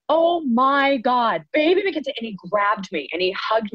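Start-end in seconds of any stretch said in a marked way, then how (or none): 0.1-2.1 high, drawn-out "oh my God" of astonishment; acted delighted disbelief, pitched well up; amazed recognition. De Niro recognising the grown-up child actress, amazed.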